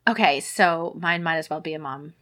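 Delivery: usual speaking voice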